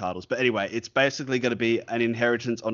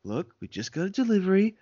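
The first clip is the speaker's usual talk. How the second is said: lightly acted clerk, pitched high; placating shop clerk; 10 semitones higher than usual. Store worker to screaming customer, lightly acted high placating voice. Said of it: "And I'd just be like".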